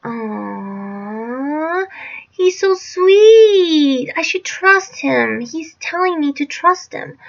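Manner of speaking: high, drawn-out mock-sweet coo; naive cooing. Girl falling for stepfather's promise, mock-naive high cooing. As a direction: gush slowly, mocking your own gullibility. Mocking own naive trust.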